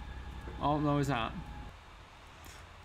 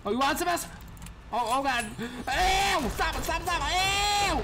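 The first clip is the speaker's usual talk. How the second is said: panicked squeals; 13 semitones higher than usual. Real, not acted. Player fending off a gross monster, squealing high. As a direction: squeal at it to back off.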